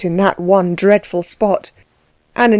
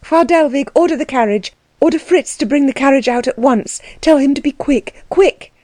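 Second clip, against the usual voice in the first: high, agitated commands; urgent orders; 6 semitones higher than usual. A narrator's reading. Anna to Frau Dellwig wanting the carriage, urgent orders. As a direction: build in urgency to a high final word.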